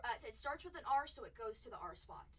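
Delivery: normal talking voice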